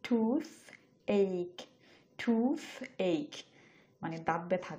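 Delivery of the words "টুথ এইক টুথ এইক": "টুথ এইক" drawn out, a little longer; stretched toothache. Shown as a demonstration. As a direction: stretch "টুথ এইক" slowly so it is easy to follow. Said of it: "একটু বড় উচ্চারণ করলাম শব্দটা". ("টুথ এইক" একটু টেনে লম্বা করে)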